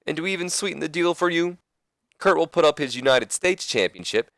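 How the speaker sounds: high-pitched, animated wrestling manager; Ric Flair. Acted. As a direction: cut in with an excitable hammed-up promo. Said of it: "And that's when Flair says".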